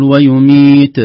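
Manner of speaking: loud ويميت with a voiced bounce after the ت; bouncing final ta. Shown as a demonstration. Teacher shows the qalqala mistake, bounced ending. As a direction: echo the ت of ويميت with a tiny vowel, "wayumeete". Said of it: "يقلقل، لا نقول".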